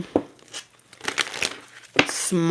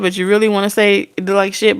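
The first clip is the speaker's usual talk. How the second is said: normal talking voice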